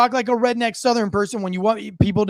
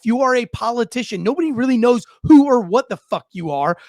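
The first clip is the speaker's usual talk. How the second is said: usual speaking voice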